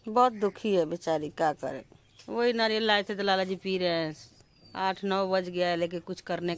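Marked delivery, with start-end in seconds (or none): none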